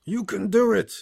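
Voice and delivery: high, loud cheer in a put-on voice; bystander calling out. Bystander calls to dying Otto, high hammed-up shout. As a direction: holler encouragement like an onlooker. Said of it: "A bystander calls out".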